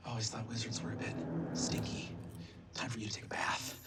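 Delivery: low, soft, half-whispered taunt; menacing villain murmur. Acted. Jace taunts Adine the wizard before attacking, soft low menace. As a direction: sink into a soft, low threat with pauses. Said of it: "and says".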